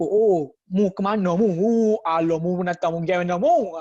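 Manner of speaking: teacher imitating a Kelantanese speaker; Kelantanese accent. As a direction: play a Kelantan local asking where you're off to. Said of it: "dia akan cakap logat Kelantan".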